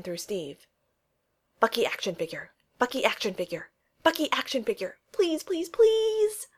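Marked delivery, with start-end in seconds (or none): 1.6-6.5 desperate hope rising to a squeal; building excited pleading. A narrator's reading. Steve begging Phil gets a Bucky figure, building excitement.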